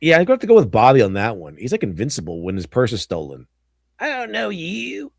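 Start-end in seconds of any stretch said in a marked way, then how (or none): 4.0-5.1 mock high cold brush-off. Quoting meme line in voice.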